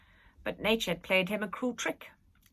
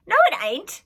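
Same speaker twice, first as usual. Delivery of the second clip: high, loud sharp retort; snapping; 11 semitones higher, 12 dB louder than usual. A narrator's reading. Street girl snaps back at Nanny, high and loud. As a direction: snap back defiantly. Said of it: "snapped the girl".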